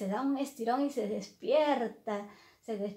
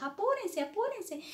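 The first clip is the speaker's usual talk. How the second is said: much higher, urgent gardener voice; hammed-up frightened urging; 10 semitones higher than usual. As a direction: warn them the boss is coming, high and flustered.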